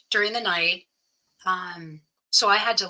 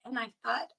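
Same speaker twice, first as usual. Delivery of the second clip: ordinary talk, nothing marked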